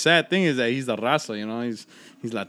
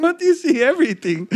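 laughing through the words; barely getting the words out for laughing; 5 dB louder than usual. Retold or imitated. Laughing, quoting his own reply.

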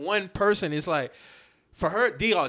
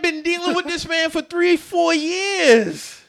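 high, raised-voice protest; loud exasperation; 9 semitones higher, 4 dB louder, slower than usual. Real, not acted. Co-host protesting that she had to know, loud and exasperated. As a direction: argue it loudly, pitched way up. Outraged backing of the challenge.

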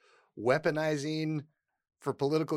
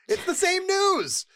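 slow, much higher and louder, every word leaned on; exasperated emphasis; 5 dB louder, slower than usual. Real, not acted. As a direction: drive the point home in disbelief. Exasperated point about the coverage.

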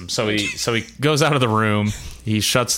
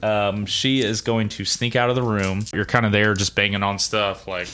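ordinary delivery

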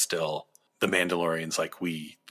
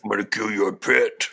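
grumpy alien voice; 5 dB louder than usual. Retold or imitated. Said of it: "the grumpy Quarrens".

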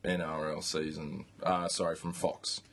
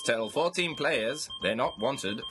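headline read; 6 semitones higher, slower than usual. Acted. Reading out the big headline.

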